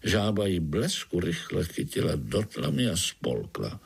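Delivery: ordinary delivery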